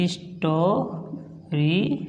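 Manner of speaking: Stories drawn out very slowly and softly; stories stretched long and quiet; slow modelled word. Shown as a demonstration. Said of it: "इसलिए इसको पढ़ेंगे".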